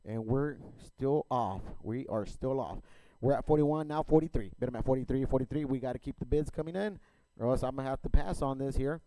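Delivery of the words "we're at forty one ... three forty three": rapid rhythmic auction chant; auctioneer. Real, not acted. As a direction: rattle off bids like an auctioneer. Auction chant bidding calls.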